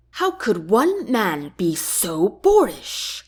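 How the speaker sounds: ordinary delivery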